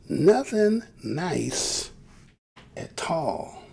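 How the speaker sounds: "Nothing nice" drawn out very slowly; deliberate, spaced-out "Nothing nice at all"; slow emphasis. Real, not acted. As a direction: stretch "Nothing" and "nice", then settle on "at all". Repeats phrase slowly for emphasis.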